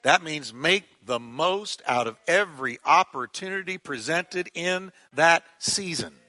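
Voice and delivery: normal talking voice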